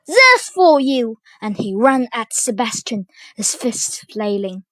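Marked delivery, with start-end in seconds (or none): 0.1-1.2 loud, slow battle cry; raised, squealed challenge; squealing attack. A narrator's reading. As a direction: throw it out like a punch. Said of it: "squealed Sir Andrew".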